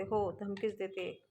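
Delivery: slow, soft and menacing; quiet threat. A narrator's reading. Aishwarya coolly confirms her threat to Maya, slow soft menace.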